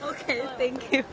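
ordinary delivery

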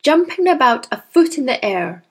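usual speaking voice

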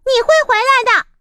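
高、快、响地吼; 猫气冲冲地大吼; angry cat roar. Acted. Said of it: "猫生气地大吼". (猫冲着离开的鸭子生气大吼)

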